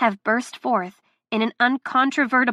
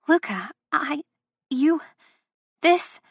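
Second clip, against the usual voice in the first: broken stammer climbing higher; halting, lost for words; 6 semitones higher than usual. Acted. Delilah tongue-tied facing Luca, halting and climbing. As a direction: choke on each word, pitch rising. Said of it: "I can't form words".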